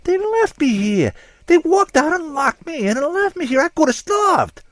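hammed-up suspect crying out he was abandoned. A narrator's reading. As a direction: wail it like the world just ended. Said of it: "he cried".

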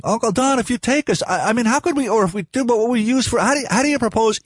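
high, breathless tumble of half-finished questions; words racing. A narrator's reading. Boy pelts his uncle with questions, words racing. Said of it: "his words racing".

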